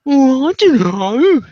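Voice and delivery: very high, slow, dazed drawl like someone on nitrous. Retold or imitated. Mocking a dazed girl in the hall: high, slow, spaced-out drawl. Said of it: "that's how she sounded".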